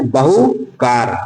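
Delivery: बहुकार drawn out slowly, बहु and कार apart; teacher slowly spelling out बहुकार by sound. Shown as a demonstration. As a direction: stretch बहुकार to show its two sounds. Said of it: "देखिए यहाँ पे बहु sound".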